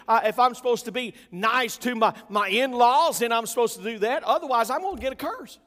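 earnest anxious resolve. Retold or imitated. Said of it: "I'm like".

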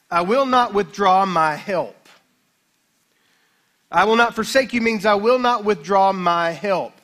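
loud, ringing preacher's voice; full-force preaching. Acted. Preacher proclaiming God's promise to the church, loud and fervent. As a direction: drive the promise home to the congregation.

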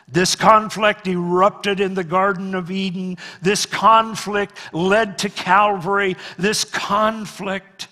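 loud, high, driving sermon; preaching at full force. Acted. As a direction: hammer every point home to the church.

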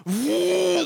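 high mock whoop of drivers speeding off; wild whoop. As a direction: let out a hammed-up whoop. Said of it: "they're like".

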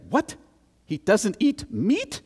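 high, slow, incredulous mother; mock-appalled Greek mom, drawn out; a mother who can't believe her ears; shocked disbelief. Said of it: "She's just blown away".

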